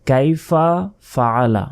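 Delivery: "কাইফা", a pause, then "ফাআলা" with the glottal break; separate words. Shown as a demonstration. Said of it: "তাই বলতে হবে".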